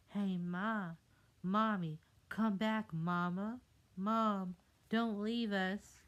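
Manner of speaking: put-on high, loud begging kids; pleading child voices. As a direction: beg mom not to leave. Tadpoles beg mother to stay.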